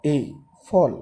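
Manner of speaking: ordinary delivery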